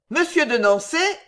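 high, loud, commanding address; calling out. A narrator's reading. Queen addresses captain as threat.

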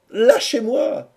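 very high, slow, loud plea; pleading cry. A narrator's reading. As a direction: cry out to be let go, drawing it out. Captive pleads to be released. (cri suppliant, aigu et traînant)

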